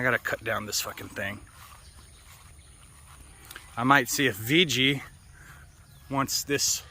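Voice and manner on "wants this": normal talking voice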